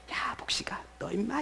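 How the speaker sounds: hushed, half-whispered scolding; mock nagging. God nagging the pastor through the Word, hushed mock scolding. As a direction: nag softly, as if under the breath. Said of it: "잔소리를 하는 거예요".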